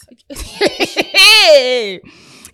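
a big high shriek of a laugh; loud burst of laughter. Real, not acted. Big laugh at friend's line.

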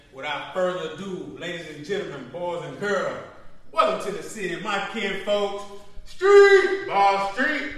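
loud hype-man intro, building; grand entrance announcement. Acted. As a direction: announce the guests like a ring announcer. Podcast host introduces guests.

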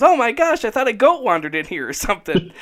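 high mock shriek of a startled wife; retold wife's scream. Wife walking in on him naked, a high mock shriek. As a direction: squeal it like she just saw a goat. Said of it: "She comes in, screams".

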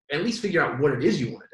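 usual speaking voice

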